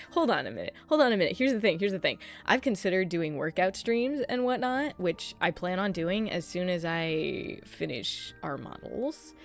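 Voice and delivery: ordinary talk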